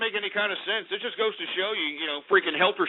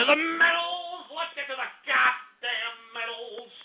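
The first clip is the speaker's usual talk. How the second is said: mock announcer cry; 7 semitones higher, 3 dB quieter than usual. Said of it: "let's get to the metals".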